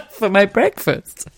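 retold request breaking into laughter; laughing. Pregnant woman asking lads for pasty, laughing. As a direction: giggle through the punchline.

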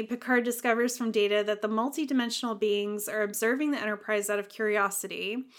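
ordinary talk, nothing marked

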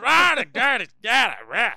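loud, high nonsense ranting; mock angry Scots. Host imitating Scots mad in their mountains, mock angry ranting. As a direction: rant in wordless, hammed-up fury. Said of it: "they get mad in their mountains".

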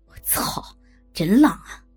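impressed male grunt of a line; snorting exclamation. A narrator's reading. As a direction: huff the curse, punch the rest. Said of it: "小三哼了一声".

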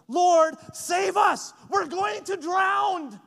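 slow, high, loud cry for help; desperate shout. Retold or imitated. Disciples waking Jesus in the storm, slow desperate shout. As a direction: shout it out like the boat is sinking.

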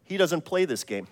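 quiet, unruffled reply; serenely understated punchline; calm. Acted. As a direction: drop to a soft, matter-of-fact calm. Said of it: "Saint Peter just calmly says".

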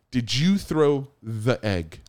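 slow, deliberate questioning; slow interrogation. Retold or imitated. Cop finally confronting the teenage suspect, slow and deliberate. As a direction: spell out the accusation word by word.

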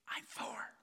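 put-on small boy whispering into the phone; whispering child. Little Jimmy answering the pastor's call, whispering. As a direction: breathe it like a hiding four-year-old. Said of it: "a voice answered with a whisper".

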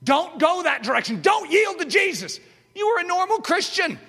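loud, high-pitched tempting voice; the flesh nagging a believer, loud and high. Acted. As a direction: shout like the inner voice pulling you back. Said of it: "that voice is loud".

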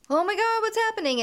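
high-pitched alarmed outburst; mock panic. Retelling Sam finding Andy dead, mock panicked. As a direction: act out a hammed-up shock.

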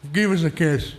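put-on a light Irish accent; quick Irish brogue on a short quote; imitating Irish accent. Showing students Christy Brown's voice, a hint of Irish accent. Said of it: "I love the sound of his voice".

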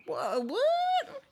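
very high-pitched mock astonishment; stunned disbelief. Son hears old-school dad offer help, stunned. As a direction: squeal it in exaggerated disbelief.

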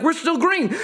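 high-pitched urgent reply; mock-tense status shout; crewman reporting. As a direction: imitate a crewman calling back. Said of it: "felt like we were on a ship".

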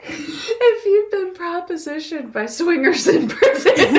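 high, giggling disbelief; laughing in disbelief at the idea. Real, not acted. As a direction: say it through real laughter, way up high. Repeating the line while laughing.

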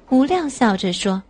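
ordinary delivery, nothing marked

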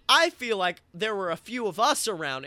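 ordinary talk, nothing marked

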